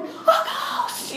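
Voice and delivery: very high mock shriek; thin, squeaky pretend terror; freaking out. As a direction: squeal it in comic panic. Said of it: "they're all like".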